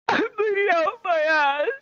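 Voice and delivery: high, slow squealed confession; pained wail. Retold or imitated. Soaking kid confessing to the nurse, high pained wail. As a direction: squeeze it out in mock agony.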